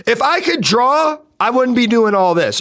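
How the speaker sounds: usual speaking voice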